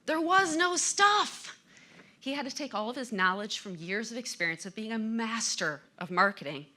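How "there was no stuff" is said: slow, much higher and louder, each word landed; emphatic punchline. Acted. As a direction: hit every word of "There was no stuff" hard and slowly. Punchline in the story.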